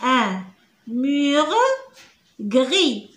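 ordinary talk

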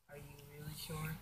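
breathy whispered question. Acted. Toy figure whispers a doubtful question to Spider-Man, whispered. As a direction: whisper it so nobody hears. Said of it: "Speak a little louder".

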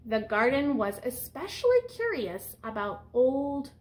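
picture-book read-aloud voice; reading to children. Acted. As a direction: read it out to the class like a storyteller. Teacher reads picture book aloud.

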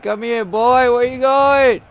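ordinary delivery

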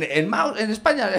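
ordinary talk

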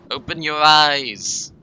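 slow, drawn-out quoting Nico; Nico impression. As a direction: drag it out like Nico. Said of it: "as, uh, Nico would say".

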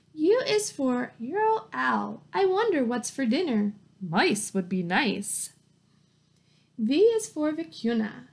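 bright, lively picture-book read-aloud; reading to children. Acted. Teacher reading a zoo alphabet book to her class, lively read-aloud. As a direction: read it like story time for little kids.

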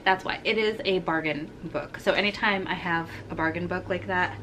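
ordinary talk

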